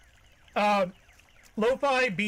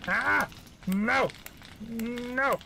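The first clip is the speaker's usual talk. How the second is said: startled panic; 4 semitones higher, much slower than usual. Real, not acted. Character catches fire in game.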